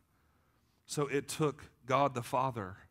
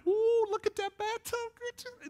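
very high, slow falsetto ooh; gushing excitement; 3 dB louder, much slower than usual. Retold or imitated. Husband viewing a house, hammed-up excited gushing. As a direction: squeal over it in mock delight. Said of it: "I get all excited".

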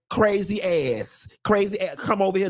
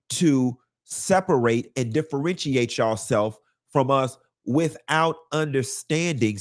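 usual speaking voice

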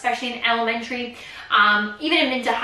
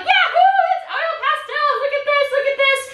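put-on high, giggly kid voice; squealy delighted kid; excited kids; 13 semitones higher than usual. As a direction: bounce like a thrilled child.

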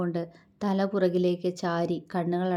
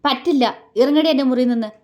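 loud, high furious outburst; angry roar; 5 semitones higher, 8 dB louder, faster than usual. A narrator's reading. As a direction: bellow an order to get out. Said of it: "അതൊരു അലർച്ചയായിരുന്നു". (ദേഷ്യത്തോടെയുള്ള അലർച്ച)

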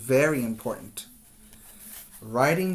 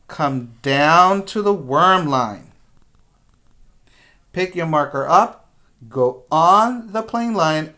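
guiding a class through letter shapes, firmly; step-by-step teacher voice; 3 semitones higher, 5 dB louder than usual. Shown as a demonstration. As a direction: call each stroke out to young kids. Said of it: "teacher models letter strokes".

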